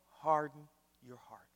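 whispered, intimate warning; hushed warning. Acted.

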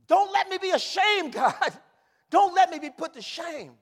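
shouted, high-pitched prayer; impassioned plea. Acted. Preacher begging God not to be ashamed, impassioned shout. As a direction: cry it out high and very loud.

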